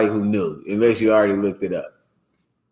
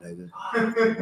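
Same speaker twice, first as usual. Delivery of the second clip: ordinary talk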